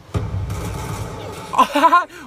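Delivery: high, loud cackling laugh; cracking up at the trade; burst of laughter. Real, not acted. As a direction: laugh out loud at the double kill. Both players killed each other.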